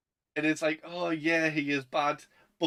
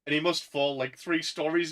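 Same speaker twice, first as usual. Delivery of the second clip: ordinary talk, nothing marked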